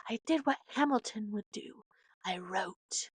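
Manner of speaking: breathy whispered quote of Eliza. Retold or imitated. Whispering Eliza's last words about Hamilton. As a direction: drop to a whisper at the end. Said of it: "Eliza was like".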